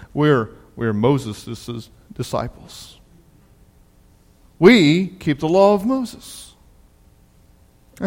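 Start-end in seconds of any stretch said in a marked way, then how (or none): 0.1-6.5 slow, lower, pompous reply; hammed-up self-righteous drone; smug Pharisees. Pharisees dismissing the healed man, slow pompous mock.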